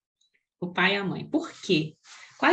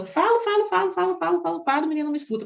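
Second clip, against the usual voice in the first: higher, exasperated repetition; exasperated parent; 6 semitones higher, faster than usual. Retold or imitated. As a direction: vent like a worn-out parent. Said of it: "algumas famílias chegam e falam". (pai exasperado repetindo, mais agudo)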